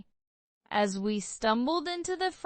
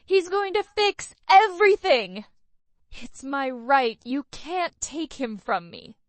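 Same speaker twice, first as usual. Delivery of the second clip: screaming; 4 semitones higher, 6 dB louder than usual. A narrator's reading. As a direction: rave as they cuff her. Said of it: "She was screaming the truth".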